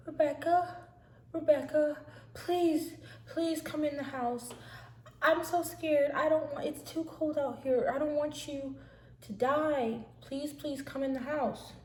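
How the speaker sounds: high-pitched, imitated tearful begging; mock crying plea. Sister pleads with Rebecca in the cold, mock crying. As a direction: beg her to come in, acting upset. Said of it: "and she's crying".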